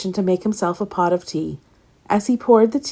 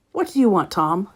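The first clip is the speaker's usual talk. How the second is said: plain reading voice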